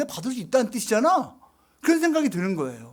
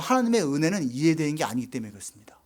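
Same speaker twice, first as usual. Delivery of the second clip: ordinary talk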